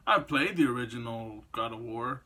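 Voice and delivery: ordinary delivery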